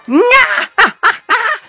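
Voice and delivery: high, gleeful burst of laughter; delighted cackle. Real, not acted. Delighted by the fight. (éclat de rire aigu et ravi)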